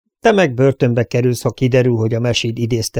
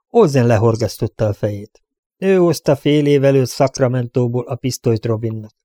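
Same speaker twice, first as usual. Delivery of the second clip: usual speaking voice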